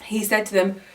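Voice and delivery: ordinary talk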